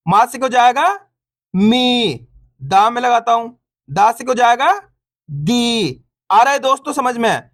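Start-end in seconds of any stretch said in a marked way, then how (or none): 1.5-2.3 long, stretched "मी"; "मी" with a sustained long ee; drawn-out vowel. Shown as a demonstration. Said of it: "बड़ी ई की मात्रा को खींच के पढ़ा जाता है". 5.3-6.0 long, stretched "दी"; "दी" with a sustained long ee; drawn-out vowel. Shown as a demonstration. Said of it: "बड़ी ई की मात्रा को खींच के पढ़ा जाता है".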